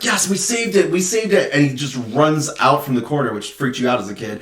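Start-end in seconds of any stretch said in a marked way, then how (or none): 0.0-1.5 excited cheer. Retold or imitated. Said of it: "he's like".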